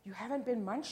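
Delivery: delighted mother exclaiming; relieved cry. A narrator's reading. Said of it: "cried Missus Hope".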